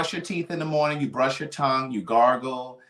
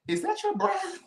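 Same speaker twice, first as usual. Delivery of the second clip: much higher, pinched disbelieving squeal; shocked high squeak; 5 dB quieter than usual. Retold or imitated. Retelling a friend's bad breath, a high shocked squeak. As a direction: gasp it out like you can't believe the smell. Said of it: "and I was just like".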